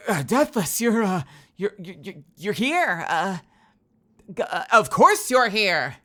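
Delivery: slow, stammering surprised boss; flustered villain voice. Retold or imitated. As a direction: stumble through it, caught off guard. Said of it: "he was not expecting that".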